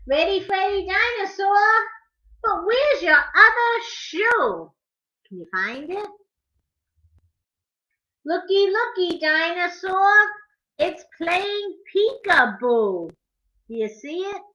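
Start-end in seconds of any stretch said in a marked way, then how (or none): 0.1-4.7 lively, sing-song picture book rhyme, higher; reading to children. Acted. 8.2-13.1 lively, sing-song picture book rhyme, higher; reading to children. Acted.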